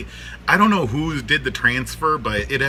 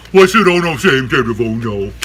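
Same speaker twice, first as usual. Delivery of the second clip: raised voice pressing for an answer; insistent repeat; 6 dB louder than usual. Real, not acted. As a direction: ask it again, projecting hard. Same question repeated louder to listeners.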